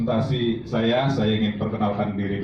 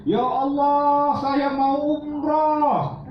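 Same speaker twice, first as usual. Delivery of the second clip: much higher, very slow imploring cry; pleading prayer; much slower than usual. Shown as a demonstration. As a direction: beg God with a drawn-out high cry. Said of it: "Yang terakhir, yang ketiga". (memohon kepada Allah dengan suara tinggi dan lambat)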